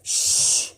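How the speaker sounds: long hissed shush; soft voiceless "shh". A narrator's reading. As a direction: hush your sister. Jack hushes Annie.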